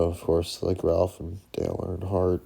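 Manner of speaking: normal talking voice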